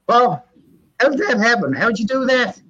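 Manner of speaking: retold amazement. Asking grandmother about the light switch, amazed. Acts out his startled younger self.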